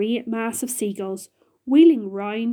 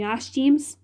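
usual speaking voice

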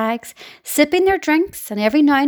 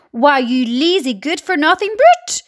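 higher, louder angry shout; furious shout; 4 semitones higher, 5 dB louder than usual. Acted. Aunt shouting at James, high and loud. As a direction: yell at the lazy boy. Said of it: "Aunt Spiker shouted".

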